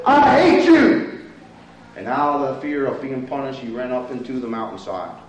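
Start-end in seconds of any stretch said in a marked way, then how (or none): 0.1-1.2 high, drawn-out yell, imitating an angry boy; imitated furious child's cry. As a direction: yell it slowly in a mock fit of anger. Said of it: "out of a fit of anger, yelled".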